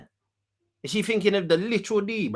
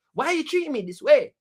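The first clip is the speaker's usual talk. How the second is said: higher, whiny hurt question; mock hurt whine; 7 semitones higher than usual. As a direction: plead like a wounded host.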